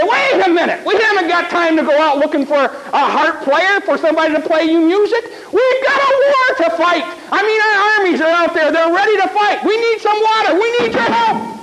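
high, urgent, protesting king voice; indignant king. Acted. King protesting to the prophet, high and urgent. King demands water for war.

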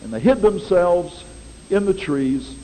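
ordinary talk, nothing marked

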